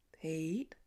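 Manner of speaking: soft, low hushed call into the dark barn; called softly. Acted. Clint softly calling into the barn for Pete. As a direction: call out gently, not wanting to startle. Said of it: "he called softly".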